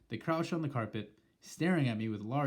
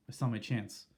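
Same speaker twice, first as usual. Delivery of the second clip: ordinary delivery, nothing marked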